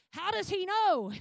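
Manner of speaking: loud, high, outraged mock demand; mad. Enemy king demanding answers of his advisors, mad. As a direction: storm it out as a furious king. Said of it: "would get so mad".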